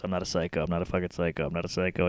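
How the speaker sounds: mock old man muttering low and soft; muttered denial. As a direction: grumble it under your breath.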